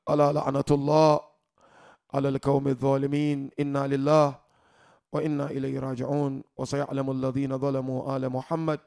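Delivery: low, soft mournful Arabic recitation; closing invocation. Acted. Preacher closing the mourning gathering with a hushed, low Arabic invocation.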